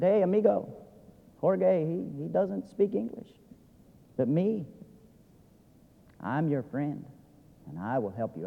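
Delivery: low, soft, sidling offer of help; sly interpreter. Retold or imitated. Sly interpreter speaks from corner.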